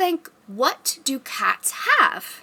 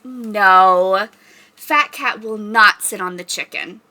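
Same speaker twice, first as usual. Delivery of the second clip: ordinary delivery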